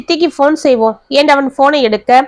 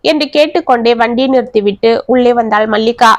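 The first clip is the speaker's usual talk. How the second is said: ordinary delivery, nothing marked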